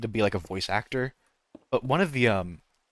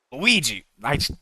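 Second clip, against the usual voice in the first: Luigi's name called out a bit louder and higher, in a rough try at the cartoon voice; attempted Luigi voice; 5 semitones higher, 5 dB louder than usual. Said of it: "I can't do it".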